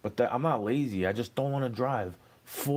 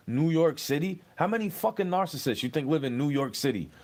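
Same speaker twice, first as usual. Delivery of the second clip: ordinary talk, nothing marked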